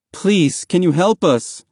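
high, earnest plea; pleading request. A narrator's reading. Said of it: "Mahmoud asked".

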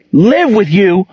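high, loud shocked shout. A narrator's reading. Gerald shouting in shock at Monique's offer. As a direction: throw it back at her in disbelief. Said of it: "he shouted".

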